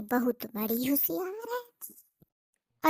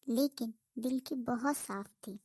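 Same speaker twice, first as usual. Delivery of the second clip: soft, even storyteller voice; gentle comic narration, quieter; story narration; 5 dB quieter than usual. A narrator's reading. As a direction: tell it like a village tale to listeners. Narrates the village tale.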